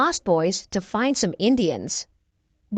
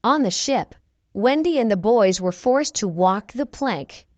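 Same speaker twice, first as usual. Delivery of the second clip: plain reading voice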